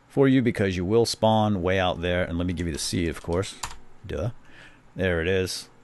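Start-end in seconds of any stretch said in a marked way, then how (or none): none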